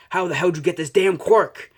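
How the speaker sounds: high, loud, angry demand; Bakugo's outraged yell. Acted. Bakugo confronts Izuku in outrage.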